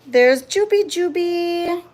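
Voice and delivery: high, slow cooing at a cat; pet talk. Real, not acted. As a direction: coo up high to the kitty. Cooing at an animal.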